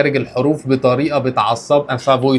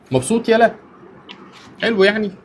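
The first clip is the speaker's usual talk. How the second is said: high, playful teasing questions; 11 semitones higher than usual. Real, not acted. Teasing a friend, high playful voice.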